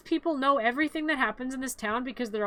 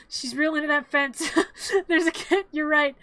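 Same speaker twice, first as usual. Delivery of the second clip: amused, laughing words, louder toward the end; real laughter; 3 semitones higher, 4 dB louder than usual. Real, not acted. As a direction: crack up mid-sentence. Cracks up over the fence.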